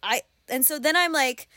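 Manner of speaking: usual speaking voice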